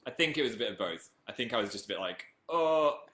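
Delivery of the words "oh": scared Northern. Retold or imitated. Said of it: "scared Northern Phil".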